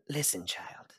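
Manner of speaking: very soft, near whisper; mother's weary address. Acted. Mom starting to reproach her child, hushed and weary. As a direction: breathe it out after the sigh. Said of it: "She goes".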